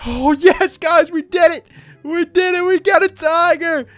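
high, loud victory cheer; overjoyed triumphant yelling; jubilant celebration. Real, not acted. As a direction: shout the win to the viewers. Celebrates getting a tiger.

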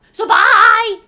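high, very loud, stretched "bye"; loud sign-off. Real, not acted. Vlogger bursting into goodbye to viewers, high shouted sign-off. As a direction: holler the goodbye.

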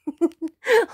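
bursting high-pitched laugh. Real, not acted. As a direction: laugh out at the memory. Laughing at her own story.

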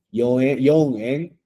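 "yoen, yonen" spelled out slowly; slow counting. Shown as a demonstration. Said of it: "pero sería ichien". (conteo lento de "yoen, yonen")

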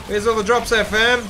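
hyped gamer bro. Real, not acted. Excited at loot.

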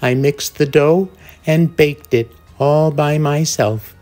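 loud, high, indignant refusal; indignant hen. Acted. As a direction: scold them, each phrase firmer. Hen refuses the lazy animals.

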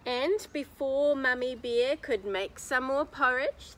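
ordinary delivery

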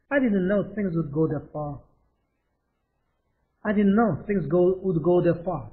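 very slow, every word clear; slow model reading. Shown as a demonstration. Teacher drilling the sentence slowly for the class. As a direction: lay it out slowly for learners.

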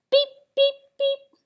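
high-pitched horn toots; three tiny high beeps; truck beeps. A narrator's reading. Truck drives off beeping.

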